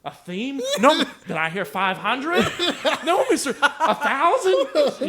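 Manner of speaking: high, loud, slow teasing bidder; mock auctioneer teacher. The teacher mocks the student, high loud auctioneer call. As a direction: auction off the punishment like a showman.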